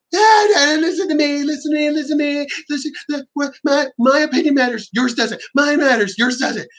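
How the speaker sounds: hammed-up high whine insisting only they matter; mocking self-centred arguer. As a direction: ape a loudmouth who won't listen.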